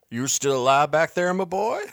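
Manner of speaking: high, loud call across the storm; gruff old man hollering for the boy. Acted. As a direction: call back into the dust. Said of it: "Bartleby's voice called out".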